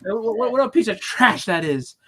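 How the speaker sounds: high, loud, exasperated yelling; mock yell. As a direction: shout him down with laughing outrage. Retelling yelling at a teammate.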